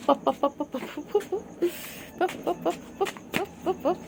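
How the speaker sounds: high, soft repeated pop calls; soft high-pitched kitty calls; pet calling sounds. Real, not acted. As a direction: coax the cat with little pop noises.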